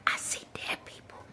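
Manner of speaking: hushed, creepy imitation; whispered movie quote. Vlogger jokingly quotes a film, creepy whisper. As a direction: whisper it like a frightened film line. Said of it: "Or how they say".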